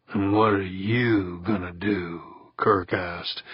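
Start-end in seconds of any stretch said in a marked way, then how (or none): 0.1-2.2 wary, distrustful question; heavy with suspicion. A narrator's reading. Kirk questioning the skipper's plan, suspicious. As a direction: ask it as if doubting him. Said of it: "his voice heavy with suspicion".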